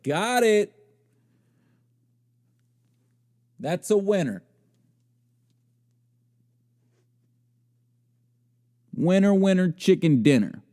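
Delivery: high, sing-out mock triumph; mock self-satisfied approval. Acts woman approving her own outfit.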